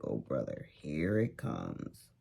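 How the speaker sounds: seeing Susie coming again, drawn-out weary groan. Retold or imitated. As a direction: sink into the dread. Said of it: "You're like".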